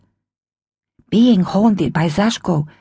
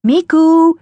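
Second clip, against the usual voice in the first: higher, louder call of a name; calling again; 10 semitones higher, 6 dB louder than usual. Acted. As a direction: call out across the flat once more. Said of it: "Mum called again".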